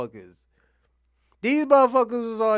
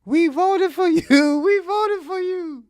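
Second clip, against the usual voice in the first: high, slow whiny wail; mock tearful high-pitched plea; mocking crying voter; 9 semitones higher, 6 dB louder, much slower than usual. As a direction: imitate her pleading sob. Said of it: "I'll tell you what happened at the end".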